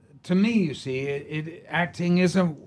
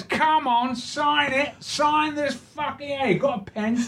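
hammed-up Cockney pub heckler, pitched way up; Cockney fan; 13 semitones higher than usual. Cockney stranger barging into the pub snug, high hammed-up Cockney. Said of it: "He goes".

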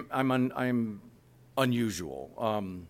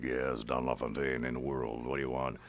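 Don LaFontaine impression; 9 semitones lower, 4 dB quieter than usual. Woken at three in the morning, answering in the deep trailer voice. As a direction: answer the phone half asleep like a trailer legend. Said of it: "those guys actually sound that way".

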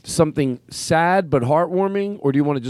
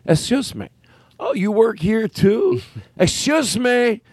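slow, high, drawn-out "Excuse me" with mock offense; pushy usher character; 6 semitones higher, slower than usual. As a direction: stretch "Excuse me" like you're deeply offended. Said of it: "This is a character that I made up".

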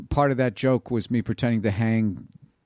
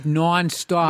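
nonstop with a nasal, drawn-out Boston o; exaggerated Boston nonstop; Boston accent; 6 semitones higher than usual. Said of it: "you got it good".

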